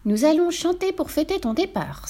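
put-on high, eager children's voice; excited little brothers. A narrator's reading. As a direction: cry it out like excited cubs. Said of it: "s'écrient ses jeunes frères".

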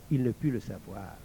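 ordinary delivery, nothing marked

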